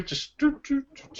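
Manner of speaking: high, soft doo doo hum; robot dance hum. Pretend. Cohost humming the beat of Spider-Man's robot dance.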